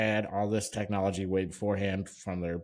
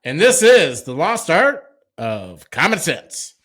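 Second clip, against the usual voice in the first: shouted show title, high; loud announcer; 5 semitones higher, 11 dB louder than usual. Acted. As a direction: announce it to a stadium.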